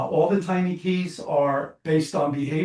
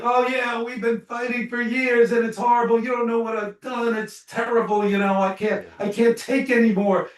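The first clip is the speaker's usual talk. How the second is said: higher, whiny voice of a fed-up spouse; complaining couple; 7 semitones higher than usual. Retold or imitated. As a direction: mimic a couple at the end of their rope. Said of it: "couples will say to me".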